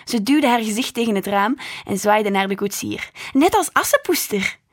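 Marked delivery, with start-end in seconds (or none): 3.3-4.6 higher, louder eager chatter; excited little girl. A narrator's reading. Little sister waving at the coachman, thrilled and high. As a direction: gush like a thrilled small child.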